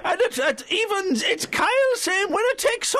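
very high Scouse show-host voice; excitable Liverpool quizmaster patter; Jimmy Tarbuck. Acted. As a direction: sing it out high in hammed-up Scouse. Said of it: "I was doing a Scouse accent".

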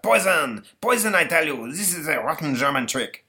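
hammed-up French indignation; outraged Napoleon. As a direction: cry out like a poisoned emperor. Napoleon outraged after spitting drink.

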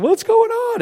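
high-pitched imitation of a screaming child; imitated scream. Woken boy screaming from his room, mock scream. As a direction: cry it out way up high, mimicking a panicked kid. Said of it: "I hear Zachary in his room screaming".

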